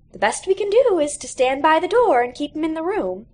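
a worn-out teacher giving up; weary teacher. A narrator's reading. Teacher in the lounge admits defeat, lightly voiced.

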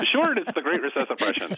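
playful high Irish brogue; hammed-up Irish lilt, laughing; Irish accent. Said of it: "you sound Irish there".

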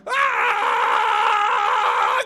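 long, high, loud wail; sustained falling-animal scream; leopard's cry. Acted. Leopard falls into the pit, long loud cry. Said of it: "we all heard him cry out".

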